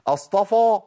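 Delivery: أصطفى with a thick dark fa; heavy fa. Shown as a demonstration. Teacher showing the mistake in recitation. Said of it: "وأجي في القرآن أقول".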